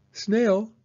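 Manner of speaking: high, loud call to a friend's door; young turtle calling at the door. Acted. Franklin calls at Snail's house.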